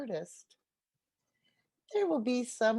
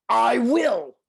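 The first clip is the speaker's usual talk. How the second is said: loud coyote; 11 dB louder than usual. Acted. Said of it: "turtle begs".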